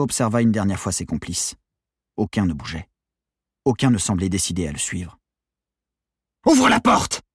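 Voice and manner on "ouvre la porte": very high, loud command; yelled. A narrator's reading. Gunman to hesitating hostage, yelled. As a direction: scream the order at the hostage. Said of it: "hurla-t-il".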